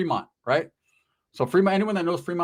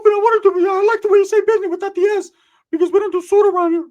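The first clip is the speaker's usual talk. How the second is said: high-pitched screaming character, very loud; Ricky's outraged shriek; 9 dB louder than usual. Acted. Ricky mocks and picks fight.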